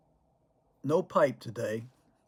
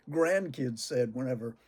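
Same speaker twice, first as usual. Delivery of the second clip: ordinary talk, nothing marked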